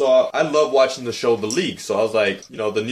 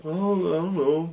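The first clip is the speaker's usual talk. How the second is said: sheepish quote; 8 semitones higher, slower than usual. Retold or imitated. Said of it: "he's just like".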